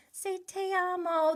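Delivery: put-on high, sing-song coaxing of a little child; parent coaxing toddler. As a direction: coax the baby to repeat. Parent coaching toddler on FaceTime.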